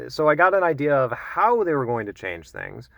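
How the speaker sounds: ordinary delivery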